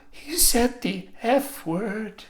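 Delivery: high, slow, shocked tattle-tale student; scandalised student. Retold or imitated. A shocked student telling the teacher, high and slow. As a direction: tell on him, gasping at the scandal. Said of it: "you can hear the class go".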